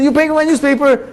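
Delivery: high, amazed disbelief; stunned by the kindness; astonished question. Retold or imitated. Neighbor astonished by gift.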